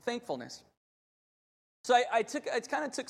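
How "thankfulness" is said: low, quiet answer from the Lord; hushed, deep divine reply; voice of God. Acted. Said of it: "I felt like the Lord said".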